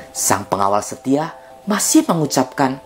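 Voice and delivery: plain reading voice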